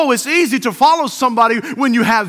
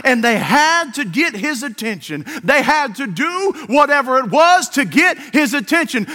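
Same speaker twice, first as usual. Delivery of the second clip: ordinary talk, nothing marked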